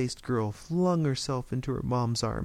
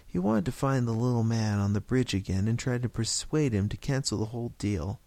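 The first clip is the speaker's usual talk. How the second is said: ordinary delivery, nothing marked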